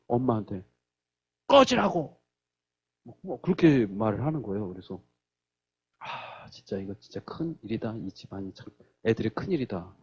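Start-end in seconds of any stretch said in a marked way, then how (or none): 1.5-2.2 high, sharp retold yell; imitated angry outburst. Son to the mother stopping the fight, imitated angry yell. 6.0-9.9 low, soft worried murmur; retold quiet dismay. Parent watching troubled children on video, retold quiet dismay.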